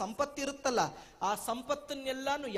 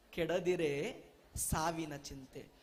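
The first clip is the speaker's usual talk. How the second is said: slow low recital; 6 semitones lower, slower than usual. Shown as a demonstration. Refrain of recited verse.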